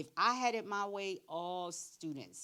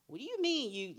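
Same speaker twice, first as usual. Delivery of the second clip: a higher, louder flash of temper; hot-headed; 8 semitones higher, 4 dB louder than usual. Retold or imitated. Younger [name] reacting to coworkers, hot-headed flare. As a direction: snap back like your younger hot-headed self. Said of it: "The hot-headed [name]".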